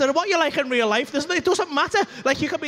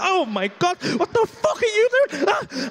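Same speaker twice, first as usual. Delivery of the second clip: shocked panic; 6 semitones higher than usual. Retold or imitated. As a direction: yelp it in sudden alarm. Man shocked by wild woman.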